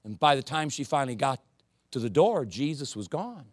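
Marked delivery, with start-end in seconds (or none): none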